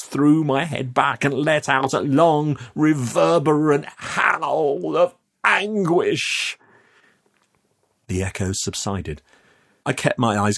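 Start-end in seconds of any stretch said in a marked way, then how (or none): 0.0-6.6 rising, drawn-out telling; anguished narration. A narrator's reading. Youth narrates his howl in the vault, anguished. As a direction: let the words swell higher like a howl.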